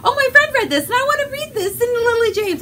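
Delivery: high-pitched excited squeal, half joking; giddy excitement. Retold or imitated. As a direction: gush like a starstruck fan. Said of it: "it's enough that I'm like".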